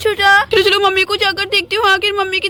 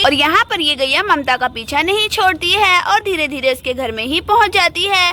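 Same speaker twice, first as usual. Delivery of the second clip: lower, even storyteller voice; story narration; 3 semitones lower than usual. A narrator's reading. Narrator telling listeners what the cow does, calm storytelling. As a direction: narrate the cow's approach calmly.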